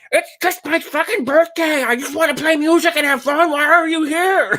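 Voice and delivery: very high, exasperated yell, imitating an aggrieved birthday guy; mock yelling. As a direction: yell back in a strained high voice, dragging out the final question. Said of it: "they're yelling back".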